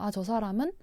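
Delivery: ordinary talk, nothing marked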